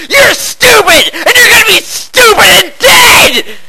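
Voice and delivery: screamed high and loud at the imp; high, loud battle rage; furious shout. Acted. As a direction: scream down the monster in fury.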